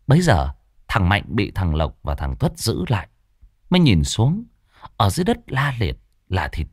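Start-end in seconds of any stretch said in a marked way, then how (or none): none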